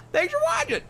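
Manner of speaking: very high, loud squeaky voice; squealed sign-off. Acted. Gamer thanking viewers for watching, high squeal. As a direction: squeal it out in a silly high pitch.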